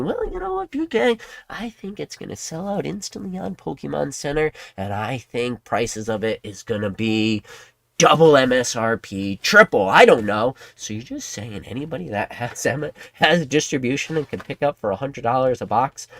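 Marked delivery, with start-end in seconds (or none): 0.0-10.5 lampoon a clueless forecaster; self-assured squeaky guessing; mocking Kimmy. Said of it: "um, Kimmy?".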